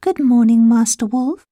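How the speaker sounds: put-on high, bright girl's reply; little girl voice. A narrator's reading. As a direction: chirp it like a polite child. Little girl answers the wolf.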